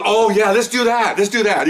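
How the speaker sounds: high, slow, eager agreement; excited. Retold or imitated. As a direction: relish the idea as the eager detective. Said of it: "He's all excited".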